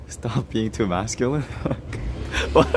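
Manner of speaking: ordinary delivery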